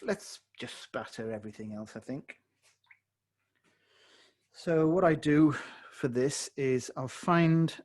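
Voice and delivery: ordinary talk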